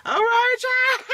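excited yell. Real, not acted. Sudden high yell.

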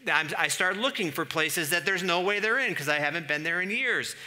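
desperately hunting everywhere; exasperated rant building; frantic searching. Pretend.

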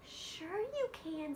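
high, slow reassuring frog. Acted. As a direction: coax her gently.

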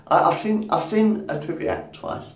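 usual speaking voice

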